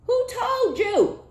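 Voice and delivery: high, loud, slowed mock indignation; hammed-up indignant squeal; mock outrage. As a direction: shriek it like an offended ex. Voicing the offended jealous ex.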